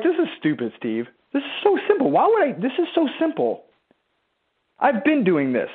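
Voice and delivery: high-pitched imitation of a scoffing doubter; mock protest. Coach mimics a listener dismissing simple advice, mock protest.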